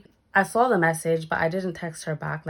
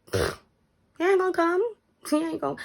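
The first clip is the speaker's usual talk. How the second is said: scoffing; 12 semitones higher, faster than usual. Retold or imitated. Mocking friend's excuse, high voice.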